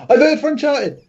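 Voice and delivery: high-pitched mock scream; waking up screaming. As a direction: pretend to jolt awake with a panicked cry. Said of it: "he'll just wake up screaming".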